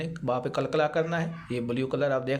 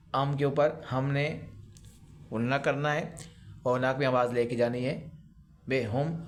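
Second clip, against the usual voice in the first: ordinary talk, nothing marked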